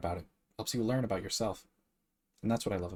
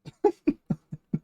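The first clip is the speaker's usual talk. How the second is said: high teary laugh; 7 dB louder than usual. Real, not acted. Laugh after trying not to cry.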